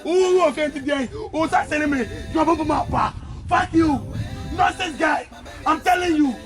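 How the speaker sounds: loud, high, raging rant; furious tirade; furious shouting. Acted. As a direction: scream at him, out of control. Furious outburst at the boss.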